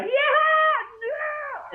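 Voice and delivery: put-on very high toddler squeal; freaked-out toddler. Dad acts out his daughter's sudden freak-out, high toddler squeal. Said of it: "then she'll get freaked out".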